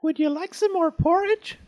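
very high, loud squeaky offer; little Baby Bear voice. A narrator's reading. Baby Bear calling after Goldilocks, squeaky little voice. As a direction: call it out in a tiny squeaky voice. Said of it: "Baby Bear's little voice".

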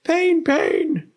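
loud, very high pug howl; yelping storybook dog in pain. A narrator's reading. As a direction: howl it like a trodden-on puppy. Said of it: "howled Pug".

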